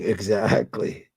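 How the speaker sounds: ordinary talk, nothing marked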